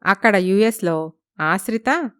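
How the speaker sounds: plain reading voice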